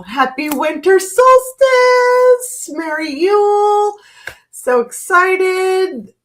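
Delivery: giddy singsong solstice wishes; excited holiday greeting. Real, not acted. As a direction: beam the greeting out, stretched.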